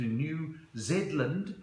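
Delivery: "New Zealand" said as "New Zed-land"; "zed" swapped in. Retold or imitated. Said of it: "it's pronounced Z".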